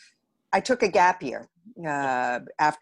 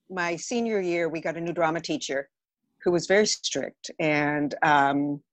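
ordinary talk, nothing marked

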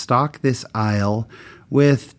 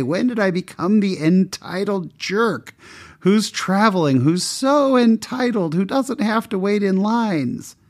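much higher, mocking the entitled jerk he became; self-scolding disgust; 9 semitones higher than usual. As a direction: scorn your own snobbery. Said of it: "I screamed".

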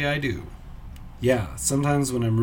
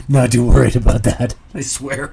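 much louder, protesting too much; mock insistent denial; 8 dB louder than usual. Insisting to the audience that I'm not worried, loud mock protest.

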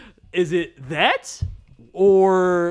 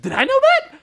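surprised; 7 dB louder than usual. Real, not acted. Surprised at own forgetting.

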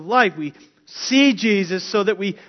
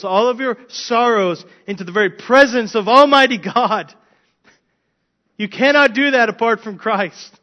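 louder, rising preaching voice; preaching at full force; 3 semitones higher, 6 dB louder than usual. Acted. Preacher urging the congregation to God's presence, loud and fervent. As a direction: lift it into a full-force appeal.

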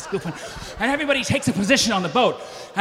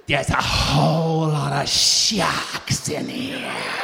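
lower, very slow mock deadpan; Meg; 7 semitones lower, much slower than usual. Meg reports sharks from the cage, slow and low. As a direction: drawl it like it's no big deal. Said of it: "she leans out and she says".

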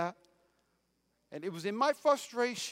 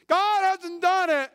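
emphatic cry; 9 semitones higher, 7 dB louder, slower than usual. Acted.

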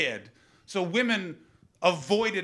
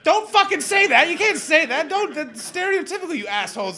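high, loud comic scolding; mock outrage; 13 semitones higher, 9 dB louder than usual. Comic berates the lads for agreeing with him, loud high mock outrage. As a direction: tear into them in mock outrage. Scolding audience after his own joke.

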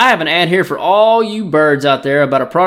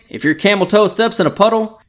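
ordinary talk

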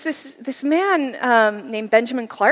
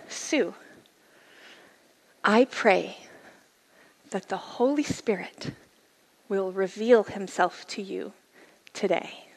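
softer, slow, hushed prayer; soft reverent reading; 4 semitones lower, 7 dB quieter, slower than usual. Retold or imitated. Reading the teacher's prayer text, hushed and slow. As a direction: read it gently like a blessing.

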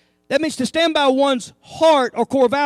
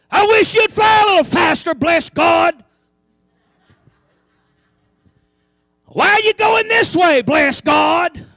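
grumbling goose; 3 semitones higher, 6 dB louder, slower than usual. Pretend. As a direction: whine and gripe, hammed-up. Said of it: "Them geese in the back aren't saying".

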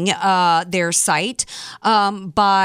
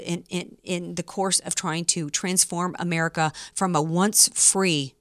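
normal talking voice

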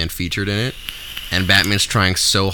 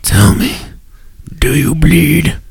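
Batman growl; 7 semitones higher, 7 dB louder, slower than usual. Pretend. Batman v Superman quote.

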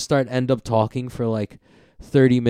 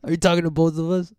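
mock tough guy; 6 semitones higher, 6 dB louder than usual. Friend hams up the tough guy.

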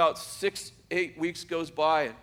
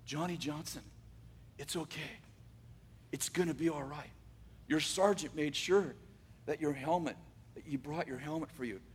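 soothing nurse; 2 semitones lower, 7 dB quieter than usual. Retold or imitated. Nurse soothing him from behind.

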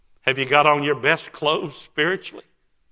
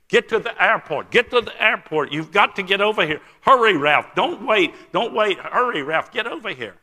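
higher, insistent, repeated urging; urgent fast talk; 8 semitones higher, 5 dB louder than usual. Retold or imitated. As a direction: urge a friend to hurry, pitch jumping up. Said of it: "he's talking so fast in broken English".

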